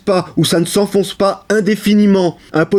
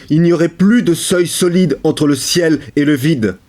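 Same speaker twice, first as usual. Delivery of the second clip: usual speaking voice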